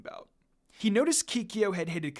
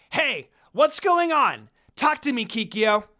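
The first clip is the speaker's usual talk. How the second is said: yelled; 7 semitones higher, 9 dB louder than usual. A narrator's reading. Inuyasha yelling at Kikyo to explain herself, loud shout. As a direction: yell out, demanding answers. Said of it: "Inuyasha yelled".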